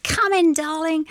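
high, lilting Cockney welcome. Retold or imitated. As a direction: quote her in a high-pitched Cockney accent. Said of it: "'cause that's Cockney".

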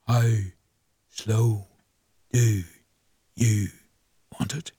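drawn-out, word-by-word, deep and low; deliberately slow. Shown as a demonstration. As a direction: stretch every word out on request. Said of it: "Tell him to say that again slowly".

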